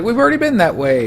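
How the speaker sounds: high, quick mock objection; mock protest. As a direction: protest to the leader, hammed up. Followers protesting to lost leader.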